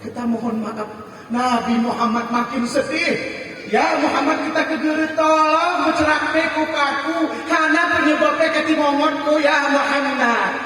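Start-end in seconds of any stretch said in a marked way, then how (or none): 3.7-10.5 higher, earnest plea; pleading wife. Acted. Wife begging her husband to tell her the cause, pleading. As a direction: beg him, earnestly.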